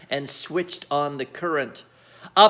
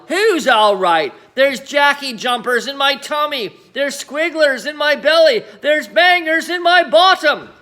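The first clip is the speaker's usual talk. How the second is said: high, loud rising complaint; yelling; 8 semitones higher, 6 dB louder than usual. A narrator's reading. Grandma rants to George about her belly, loud high rant. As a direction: rant louder and louder. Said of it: "she yelled".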